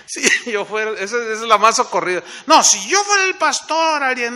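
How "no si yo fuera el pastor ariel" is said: loud, higher-pitched know-it-all claim; mock boast. Church member claiming he'd do better as pastor, mock boasting. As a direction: imitate a member boasting loudly.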